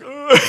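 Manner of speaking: high, loud imitated crying wail; a comic burst of blubbering, laughing after; mock sob. Speaker imitating two strangers crying together, mock sob. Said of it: "I start crying more. We're both just like".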